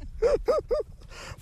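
sudden high-pitched chuckle; amused high giggle; high laugh. Real, not acted. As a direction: let a bright little laugh escape. Brother laughs at himself.

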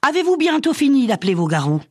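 high-pitched angry rebuke; fed-up, indignant outburst; scolding. A narrator's reading. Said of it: "Madeleine gronda".

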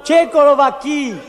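loud, high call across the bar; hearty comic shout; shouted entrance. Acted. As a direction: call out loudly as you burst in. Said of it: "Je suis entré en criant".

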